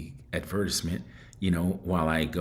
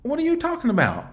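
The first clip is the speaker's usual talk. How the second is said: high-pitched disbelief, voice climbing higher at each question; incredulous outburst; 3 dB louder than usual. Retold or imitated. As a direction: retell the shock of being called dominant, pitch soaring. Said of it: "And I said".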